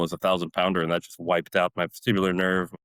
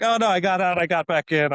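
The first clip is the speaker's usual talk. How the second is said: sheepish explaining; 11 semitones higher, 4 dB louder than usual. Retold or imitated. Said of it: "And I'm like".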